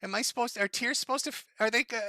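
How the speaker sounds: ordinary talk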